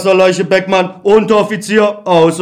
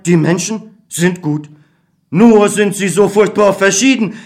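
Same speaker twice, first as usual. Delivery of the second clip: ordinary talk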